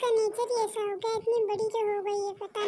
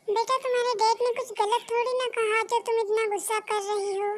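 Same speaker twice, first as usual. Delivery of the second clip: ordinary talk, nothing marked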